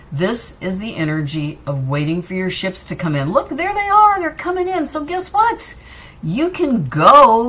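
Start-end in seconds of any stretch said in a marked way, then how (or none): 3.3-5.8 much higher, delighted; excited. Real, not acted. Excited about ships arriving. 6.2-7.5 excited. Real, not acted. Cheering listener on.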